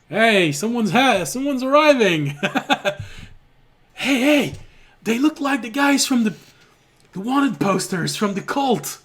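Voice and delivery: high, loud gleeful shout; excited rogue. Acted. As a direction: call out the newcomers with glee. GM voices excited rogue spotting party.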